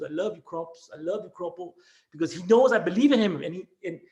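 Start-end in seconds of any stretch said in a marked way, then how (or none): 0.0-1.7 soft, lower, tearful imitation of a crying young man; a hint of the boy's tears, said quietly; tearful quote. The grown student, crying, tells his old teacher he loves him. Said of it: "he started crying".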